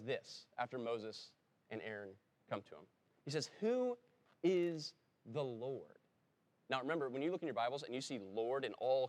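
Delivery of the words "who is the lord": high, drawn-out mocking question; lightly voiced haughty Pharaoh; scornful Pharaoh. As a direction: sneer it as a proud king who never heard of him.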